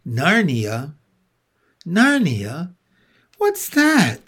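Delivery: rising, puzzled questions. Acted. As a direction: ask like she's never heard it. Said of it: "asked Lucy".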